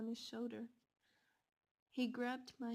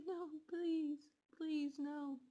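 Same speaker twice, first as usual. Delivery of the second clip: pleading; 5 semitones higher, 4 dB louder, much slower than usual. Retold or imitated. Begging the two killers.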